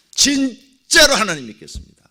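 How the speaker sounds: 진짜로 하나님 믿겠습니다 said high and loud; loud resolute promise; emphatic vow. Retold or imitated. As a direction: declare 진짜로 with force. Repeated vow, louder.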